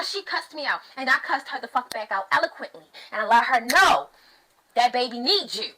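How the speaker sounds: ordinary talk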